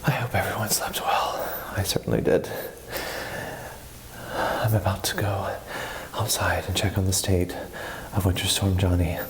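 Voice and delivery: deep announcer voice. Acted. Mock news-anchor morning intro.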